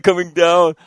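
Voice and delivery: ordinary talk, nothing marked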